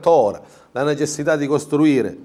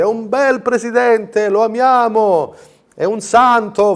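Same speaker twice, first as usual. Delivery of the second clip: high, slow, swooning mock adulation; adoring Fantozzi crowd; 9 semitones higher, 5 dB louder, slower than usual. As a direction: gush like the fawning crowd in Fantozzi. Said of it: "Tipo quel film di Fantozzi". (folla adorante alla Fantozzi, voce alta e lenta)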